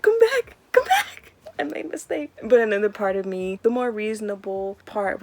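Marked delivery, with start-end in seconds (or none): 0.0-2.3 high, loud wailing come back; mock crying plea. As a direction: beg him back in a hammed-up sob. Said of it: "part of me wanted to be like".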